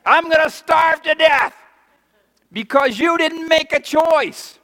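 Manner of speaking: high, loud mock rant; angry vendor. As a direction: yell like a starving shopkeeper. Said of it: "They say".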